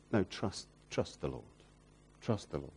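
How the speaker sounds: a hushed, tender plea to trust; gentle reassurance. Real, not acted. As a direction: soothe them quietly.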